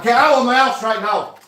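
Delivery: furious shout, high and loud. Real, not acted. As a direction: yell at him to leave. Tenant ordering landlord out.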